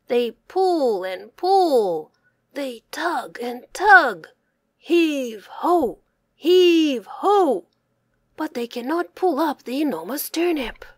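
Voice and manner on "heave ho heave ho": ordinary delivery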